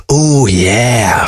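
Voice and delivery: long drawn-out mock groan; slow, lightly acted groan. A narrator's reading. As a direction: groan it with teasing relish. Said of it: "Fox groaned".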